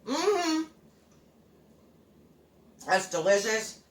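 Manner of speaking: talking through a mouthful; chewing while talking; mouth full. Real, not acted. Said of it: "Talking with my mouth full".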